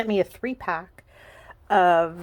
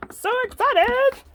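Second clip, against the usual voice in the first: "So excited" squealed much higher and louder, rising on "excited"; 8 dB louder than usual. Real, not acted. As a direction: squeal "So excited" like a thrilled fan.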